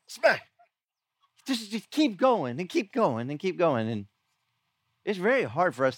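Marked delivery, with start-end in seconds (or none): 0.1-0.5 imitated slap. Brother slapped again and again.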